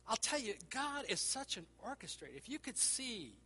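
rising, loud, slowed preaching; impassioned sermon climax; preaching fervor. Real, not acted. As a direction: build up to praise God.

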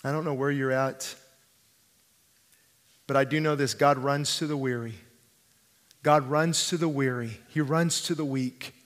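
soft, low, pastoral appeal; hushed altar appeal. Acted. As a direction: speak gently into the silence, with long pauses. Hushed appeal after the climax.

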